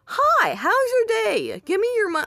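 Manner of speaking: squeaky mock robber voice; silly put-on voice. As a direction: put on a goofy high voice to tease. Put-on voice, then "just joking.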